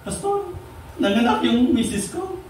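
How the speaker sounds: high-pitched mock-weepy plea; imitating a tearful father; retold tearful father. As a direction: play a crying dad pleading to his pastor. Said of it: "isang tatay na umiyak".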